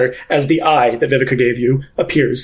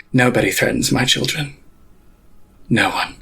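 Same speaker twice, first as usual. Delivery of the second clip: low, quiet, cold threat from a vengeful matriarch; menacing pontiff; 4 semitones lower, 3 dB quieter than usual. Acted. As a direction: speak low and deadly, like a mother guarding her brood. Pontiff again.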